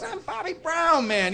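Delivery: high, drawn-out insistence; insistent. Retold or imitated. Bobby argues with the doubting cook, high and insistent. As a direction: imitate someone protesting his own identity.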